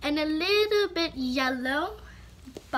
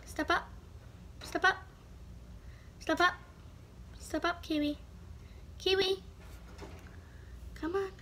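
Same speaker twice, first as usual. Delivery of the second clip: quiet, higher coaxing calls; soft coaxing; 4 semitones higher, 10 dB quieter, faster than usual. Real, not acted. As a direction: softly coax the bird to step up. Coaxing the bird, softly.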